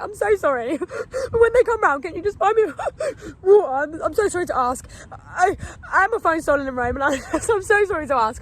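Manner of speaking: high, loud, teary voice breaking into laughs; tearful pleading. Retold or imitated. Stranded girl begging the couple for water, tearful and high. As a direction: beg through tears, half laughing. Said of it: "I just started crying a little bit as well".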